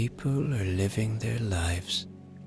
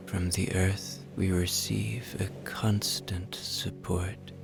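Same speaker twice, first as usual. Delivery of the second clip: guided relaxation; 8 dB quieter than usual. Acted. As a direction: ease them toward sleep, unhurried.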